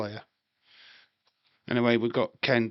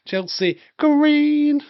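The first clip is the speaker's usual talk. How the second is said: excited drawn-out call; 14 semitones higher, 8 dB louder, much slower than usual. Real, not acted. Showing off a clip he shares.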